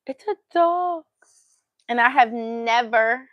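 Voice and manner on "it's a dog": high-pitched retold gasp of realization; astonished discovery. Telling how she spotted a dog, astonished. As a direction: act out suddenly realizing what it is.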